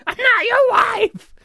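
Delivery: high, loud, drawn-out screech; shrewish shout. Acted. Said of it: "You sound like a shrew".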